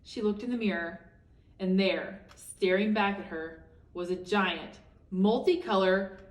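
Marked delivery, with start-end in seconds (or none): none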